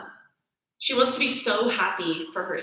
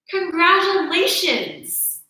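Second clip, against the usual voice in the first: grudging congratulations; 8 semitones higher, 5 dB louder, much slower than usual. Retold or imitated. Grudging congratulations, human nature.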